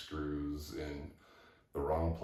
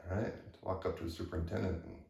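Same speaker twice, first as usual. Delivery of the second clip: usual speaking voice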